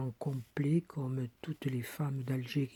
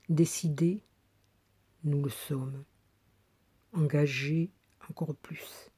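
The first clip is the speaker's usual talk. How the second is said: measured, emphatic poetry reading, key words pushed louder; poem recitation; 4 dB louder than usual. Acted. Woman reads her 1987 poem to former students, emphatic recitation. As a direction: declaim it in short phrases with pauses between.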